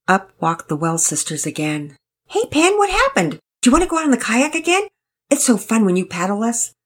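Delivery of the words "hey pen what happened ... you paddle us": put-on high, fast and bubbly little girl; eager girl voice. A narrator's reading. Sisters beg Penelope for another kayak ride, eager. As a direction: chirp it out, keen to go again.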